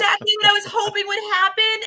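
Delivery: high, excited Oh; a thrilled little squeal of Oh; high delighted exclamation; delighted gasp. Retold or imitated. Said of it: "And it was like".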